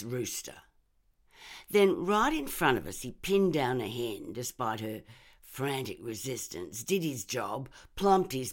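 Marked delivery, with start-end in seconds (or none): none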